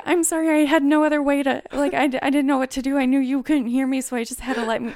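fast, high, loud apology; flustered apologizing. Retold or imitated. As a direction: rush an embarrassed apology. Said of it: "'cause you're like".

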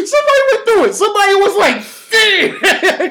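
high-pitched, hysterical amusement; cracking up, very high voice; laughing hard. Real, not acted. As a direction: push the words out through laughter. Cracking up while imagining the tester.

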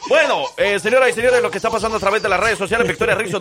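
high, loud, brisk show opener; radio host announcing. Acted. Host addresses listeners about social media news, radio announcer style. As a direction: launch the segment with projected, lifted energy.

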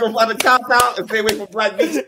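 high-pitched words squeezed through laughter; laughing. Real, not acted. Storyteller echoing friend's Curacao plan, laughing hard. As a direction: repeat the joke while cracking up. Friend repeats joke while cracking up.

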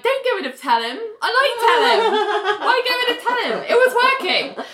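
high-pitched, laughing indignation; squealed comic objection; mock protest. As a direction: plead playfully, voice shooting up high. Mock-protesting the theme song change.

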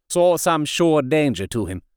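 ordinary delivery, nothing marked

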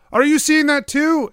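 high, loud questioning call. Acted. Jacques to the lookout above, calling up. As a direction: call up to the crow's nest. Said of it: "he looks up at the crow's nest".

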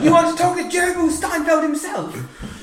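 high, drawn-out commentator voice; Murray Walker. Acted. Comedian plays a mangled Jerry Seinfeld, high commentator impression. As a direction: call it like an excitable sports commentator. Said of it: "very clearly a Murray Walker impression".

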